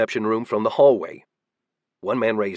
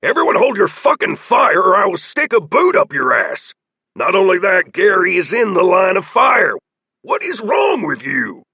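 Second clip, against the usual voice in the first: loud, higher angry shouting; furious orders; 8 semitones higher, 6 dB louder than usual. A narrator's reading. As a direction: threaten them into holding fire. Leader threatens his men.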